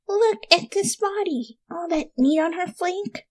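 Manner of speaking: creepy hungry pony. Acted. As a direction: savour every word like a starving zombie. Rainbow hungrily eyes Applejack.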